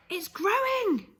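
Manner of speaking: put-on a boy amazed at the magic; excited yell. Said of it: "he yelled".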